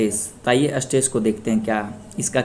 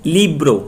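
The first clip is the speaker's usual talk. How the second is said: Libre with ली emphasized, bro falling; stress on first syllable; 2 semitones higher, 4 dB louder than usual. Shown as a demonstration. Teacher gives the rule's example, stressing ली in Libre. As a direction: lean on the ली in Libre. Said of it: "एक syllable पहले पे stress".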